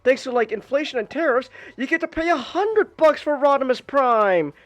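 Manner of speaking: high, loud, worked-up ranting; exasperated excited rant. Real, not acted. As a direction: vent about it at full steam. Ranting about the Rodimus reissue.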